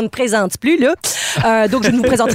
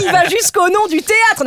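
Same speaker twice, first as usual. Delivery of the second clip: ordinary delivery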